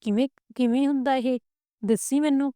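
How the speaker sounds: put-on a young wife thrilled and curious, voice lifted; eager curiosity. A narrator's reading. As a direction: ask him eagerly how it works. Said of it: "ਗੁੱਡੀ ਗੌਰ ਨਾਲ ਵੇਖੀ ਜਾਵੇ ਤੇ ਬੋਲੀ".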